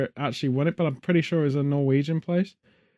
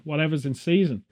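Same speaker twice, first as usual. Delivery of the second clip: ordinary talk, nothing marked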